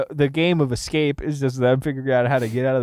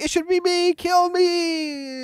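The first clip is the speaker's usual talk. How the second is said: mock pleading victim; much slower than usual. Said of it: "It's like".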